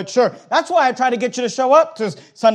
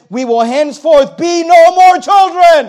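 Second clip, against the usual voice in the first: shouted slowly, higher and louder; preached shout; 6 semitones higher, 8 dB louder, slower than usual. Real, not acted. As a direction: boom the verse out, stretching each word. Preacher hits the verse's climax.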